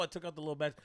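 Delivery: usual speaking voice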